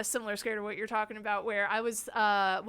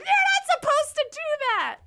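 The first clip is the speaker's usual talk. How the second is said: much higher, louder whiny protest; indignant visitor; 8 dB louder than usual. Retold or imitated. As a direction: squeal out the unfair-play complaint. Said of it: "They're like".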